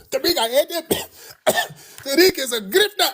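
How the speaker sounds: high sobby whine broken by coughs; hammed-up crying fit, coughing between phrases; mock crying, choking. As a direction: imitate a man choking on his tears. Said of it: "He was crying and choking on bushmeat".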